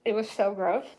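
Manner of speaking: talking through a mouthful; mouth full. Real, not acted. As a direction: say it round a bite of food. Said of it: "for talking with my mouth full".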